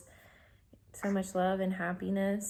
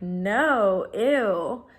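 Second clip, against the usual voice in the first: louder, grossed-out no; disgusted refusal; 9 dB louder than usual. Retold or imitated. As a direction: recoil in mock disgust. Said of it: "And Zach's like".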